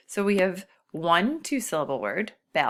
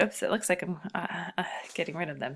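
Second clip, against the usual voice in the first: ordinary talk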